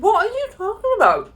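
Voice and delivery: high, loud incredulous retort; exasperated disbelief. Retold or imitated. Dog owner answering an accusation about her dog, loudly exasperated. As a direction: squawk it back in disbelief. Acted argument with the woman.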